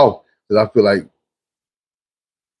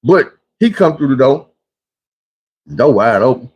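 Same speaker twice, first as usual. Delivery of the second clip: ordinary talk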